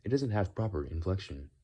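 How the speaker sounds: synthetic AI voice with odd inflection; stilted text-to-speech AI impression. Shown as a demonstration. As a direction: mimic an AI with improper inflection. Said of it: "do the A I voice".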